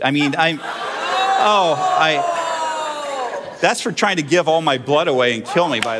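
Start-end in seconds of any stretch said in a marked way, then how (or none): 0.6-3.6 sudden high yelp, pitch jumping way up; yelping outcry. Real, not acted. Pastor mock-yelping at the congregation about a blood drive. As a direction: cry out high in mock pain.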